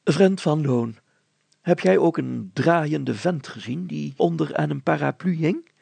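a hint of a pleading mayor; lightly voiced mayor. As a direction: ask the grocer for help. Mayor turns to grocer.

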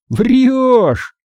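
very high exclamation; disbelieving outburst. Acted. Boy rejects alarming news. (недоверчивый высокий выкрик)

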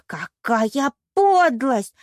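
loud, very drawn-out indignation; outraged. A narrator's reading. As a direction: stretch it into a theatrical roar. Penelope keeps roaring in outrage. (возмущённо, нараспев)

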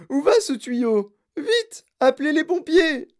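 high wailing shout of panic; howling. A narrator's reading. Mother calls for firemen, howling. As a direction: scream for help, loud and pitched right up. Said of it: "hurla Madame Gloop".

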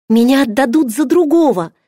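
high, loud, despairing cry; a princess begging to be saved from marriage. A narrator's reading. A princess dreading a forced marriage, despairing. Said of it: "отчаянно сказала она".

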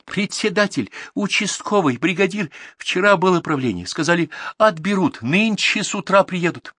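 put-on high-pitched girl's voice; urgent report. A narrator's reading. Girl tells the herders who is coming, urgently. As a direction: pour out the bad news, pitched high.

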